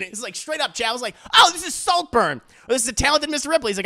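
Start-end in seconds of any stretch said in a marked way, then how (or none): 1.3-3.7 higher, louder excited outburst, fast at end; excited realization. Retold or imitated. Chad recognizing the plot, excited outburst. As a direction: blurt out the comparison. Co-host Chad's excited remark.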